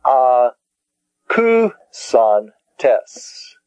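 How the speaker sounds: "A, ku, son, tes" spaced out; syllable by syllable. Shown as a demonstration. Teacher repeating "A, ku, son, tes" for learners, syllable by syllable.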